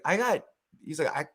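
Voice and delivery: mock British story.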